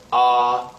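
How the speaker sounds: "ah" held on one level note; steady level-pitched "ah"; first tone. Shown as a demonstration. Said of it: "to pronounce this tone".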